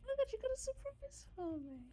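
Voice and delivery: excited gasp. Real, not acted. Hearing of a surprise.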